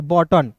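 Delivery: "बटन" with a short a, u silent; right pronunciation. Shown as a demonstration. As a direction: say "बटन" without the u sound. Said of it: "बटन नहीं पढ़ेंगे". ("बटन" का सही उच्चारण)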